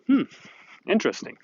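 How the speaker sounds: dry mock-impressed hmm, interesting; knowing hmm. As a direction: raise an eyebrow with your voice. Skeptical reaction to boat spending.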